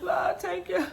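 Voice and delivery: high, breathless cry of thanks; hammed-up tearful relief; grateful praying mother. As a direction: gasp it out like mom praising God. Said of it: "just being grateful that I'm okay".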